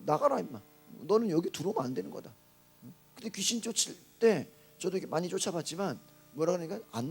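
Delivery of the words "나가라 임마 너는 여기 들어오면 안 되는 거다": usual speaking voice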